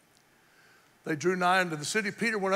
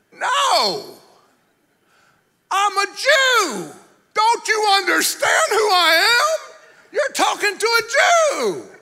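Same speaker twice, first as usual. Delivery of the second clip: high, slow, shouted protest with a laughing cry; indignant Peter; 14 dB louder, slower than usual. Acted. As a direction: Peter shouts at the Lord, offended and proud. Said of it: "a man saying to the Lord".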